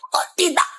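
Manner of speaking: ordinary delivery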